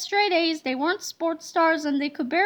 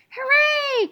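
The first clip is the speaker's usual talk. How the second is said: high mock cheer; children cheering their teacher's news, high cheer; cheering kids; 9 semitones higher, 3 dB louder than usual. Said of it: "cried the children".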